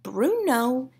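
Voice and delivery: soft, slow, whispered; calm. A narrator's reading. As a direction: say it unbothered. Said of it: "calm as ever".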